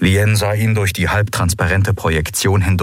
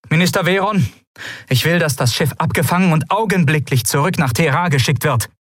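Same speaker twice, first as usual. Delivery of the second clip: commanding order; 9 semitones higher than usual. Acted. Ruler giving urgent order.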